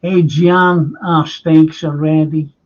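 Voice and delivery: mock call across the restaurant, unhurried; shouted over. Lad calls across the bar that the steaks are ready, loud call. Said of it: "he shouted over".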